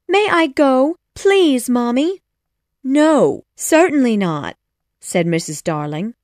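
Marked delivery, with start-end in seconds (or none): 0.1-2.2 high, loud plea; pleading child. A narrator's reading. Wendy begs her mother to go, high pleading. 2.8-4.6 emphatic mother's no; firm refusal. A narrator's reading. Mother refuses her daughter, firm no.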